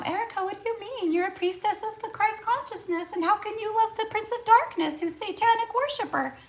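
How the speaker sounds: squeaky high mocking voice of a scold; mock critic. Mocking a follower who judges her love of [name], squeaky high. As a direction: parody a shocked, judgy follower.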